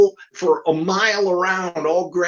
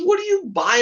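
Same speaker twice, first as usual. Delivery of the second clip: high question; 3 dB louder than usual. Retold or imitated. Ventriloquist asks puppet, high.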